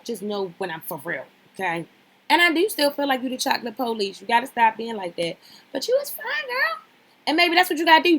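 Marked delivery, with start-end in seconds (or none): 5.7-8.2 high excited hype for her, louder at the end; hyped praise. Real, not acted. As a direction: cheer her on, squealing. Hyping up the woman.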